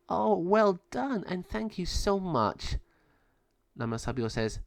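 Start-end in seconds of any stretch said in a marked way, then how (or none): 0.1-2.8 high, slow gracious voice; grateful goddess. Acted. Tonanцian receives her medallion, slow gracious thanks. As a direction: thank them slowly and graciously.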